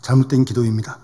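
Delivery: low-pitched, retold apology to God; apologetic prayer. Grandmother withdraws her complaint to God, apologetically. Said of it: "원망의 기도를 취소했다는".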